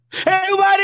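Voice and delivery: high, bright call-out; excited greeting. Real, not acted. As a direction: call out to everyone. Calling out greeting.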